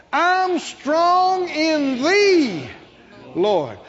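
"thee" drawn out high and loud; stress on THEE. Shown as a demonstration. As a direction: build to a long "thee", then drop on "Lord". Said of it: "begin to emphasize each word".

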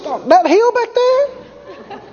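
very high, slow, laughing question; amused disbelief. Retold or imitated. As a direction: stretch out the disbelief with a chuckle. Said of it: "He said".

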